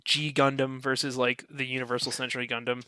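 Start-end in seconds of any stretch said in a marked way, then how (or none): none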